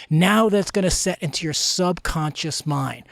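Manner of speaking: ordinary talk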